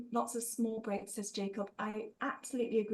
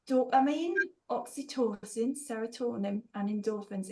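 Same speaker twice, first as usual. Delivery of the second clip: hammed-up Geordie lilt, rising on the first words; bad Geordie accent. Shown as a demonstration. Speaker obliging the chat with all four, terrible Geordie accent. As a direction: have a rough go at Geordie. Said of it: "have to say all four in a Geordie accent".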